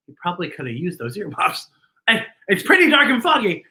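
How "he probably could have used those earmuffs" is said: usual speaking voice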